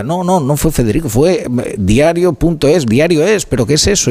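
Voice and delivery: high-pitched mock bewilderment; retold confusion. Host replaying the guest's confusion over who leaked it, mock bewilderment. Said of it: "se creó ahí una pequeña confusión".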